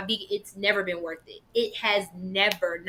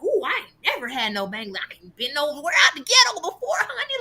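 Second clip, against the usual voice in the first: high, fast, laughing sassy voice, much higher by the end; 5 semitones higher, 3 dB louder than usual. Retold or imitated. Retelling her first Bangladeshi restaurant visit, hammed-up sassy voice. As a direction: camp it up like a wide-eyed diva who never left the block. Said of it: "I was like".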